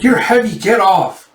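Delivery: high, loud complaint; annoyed yelp. Retold or imitated. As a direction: shove him off with your voice. Pinned under someone, protesting.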